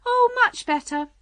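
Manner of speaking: high, loud delighted cry. A narrator's reading. Queen answering Alice about her finger, crying out. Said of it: "cried the Queen".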